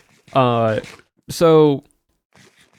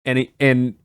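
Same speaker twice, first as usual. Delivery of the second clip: ordinary talk, nothing marked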